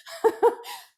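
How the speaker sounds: a quick unvoiced burst of laughter; a sharp airy laugh; breathy laugh. Real, not acted. Finishing her list of questions, airy laugh.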